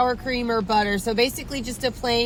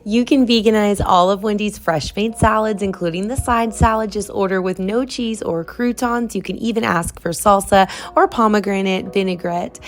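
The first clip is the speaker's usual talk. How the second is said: louder upbeat presenter read; outro read; 4 semitones lower, 5 dB louder than usual. Acted. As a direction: pitch it like a host signing off. Outro tips and sign-off.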